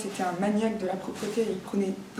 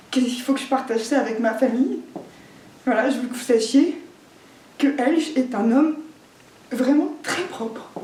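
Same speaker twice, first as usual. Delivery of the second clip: higher, emphatic public announcement; pointed declaration; 4 semitones higher, 5 dB louder than usual. Acted. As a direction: proclaim it to the whole family, stretching the last words. Pointed public declaration about the father.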